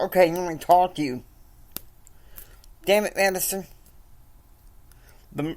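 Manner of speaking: barely holding back the anger; about to lose it. Acted. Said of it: "Kyle is about ready to lose it".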